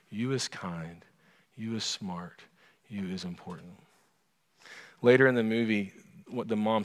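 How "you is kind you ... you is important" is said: soft, hushed loving reassurance; nanny quietly affirming the girl's worth; tender whisper-soft. Retold or imitated. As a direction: say it like a secret truth to a child. Nanny telling child the truth.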